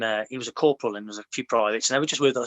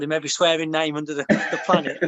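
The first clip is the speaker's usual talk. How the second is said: amused, laughter breaking into the telling; laughing while talking. Real, not acted. Soldier telling his mate a funny patrol story, laughing. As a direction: laugh through the words.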